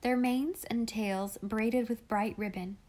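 usual speaking voice

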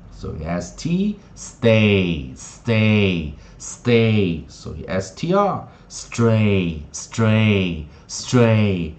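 very slow, stretched words in a steady falling chant; phonics drill. Shown as a demonstration. Teacher drilling students on onset plus AY, slow rhythmic chant. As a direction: lead a repeat-after-me drill, each word held long and evenly spaced.

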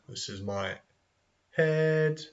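ordinary delivery

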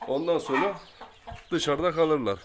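ordinary delivery

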